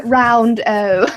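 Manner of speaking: slow, loud "round O" with a rounded vowel; posh round O. Shown as a demonstration. Showing off posh vowels, "round O" said slowly with rounded O. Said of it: "you use very round".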